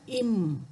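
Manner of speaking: "Im." said alone with a short closed m, as the model; a teacher modelling the second letter "Im."; Tamil letter sound. Shown as a demonstration. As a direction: show how to say "Im.". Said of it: "Macam mana kita nak bunyikan?".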